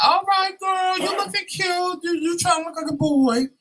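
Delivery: very high, squealed compliment. Acted. Coworker admiring his outfit for the club, high campy squeal. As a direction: squeal it like a teasing queen. Coworker greets him dressed up.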